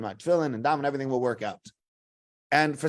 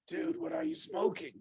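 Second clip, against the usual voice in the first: much softer, high and croaky groggy imitation; barely awake, faint incredulous protest; sleepy; 14 semitones higher, 10 dB quieter than usual. Woken counselor to his early waker, sleepy. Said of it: "in his very sleepy voice".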